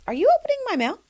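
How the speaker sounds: high-pitched questioning call-out. Shown as a demonstration. Host demonstrates confronting a nosy friend, calling it out. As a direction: ask pointedly, pitch swooping way up. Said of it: "So you go like this".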